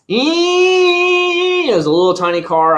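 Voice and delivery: very high, slow squeak for something tiny; mock itty-bitty voice; squeaky tiny voice. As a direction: shrink the voice down to the car's size. Mocking wife's tiny car.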